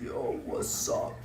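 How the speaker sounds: slow, whispery rasp; put-on husky whisper, drawn out; pressed Omen-player voice. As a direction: press the voice down like a guy acting smooth. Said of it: "Pressing on his voice".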